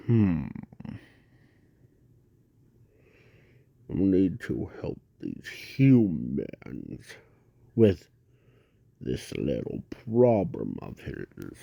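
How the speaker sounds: very low, slow, rumbling inner voice; deep monster voice. Acted. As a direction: growl it low and slow like a giant beast. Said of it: "I sound like a drunk man".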